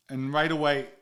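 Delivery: usual speaking voice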